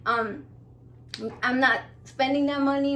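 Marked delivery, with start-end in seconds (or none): none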